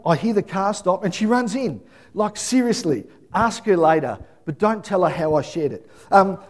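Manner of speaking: ordinary delivery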